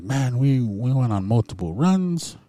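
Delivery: retold amazement. Cousin amazed he remembers nothing.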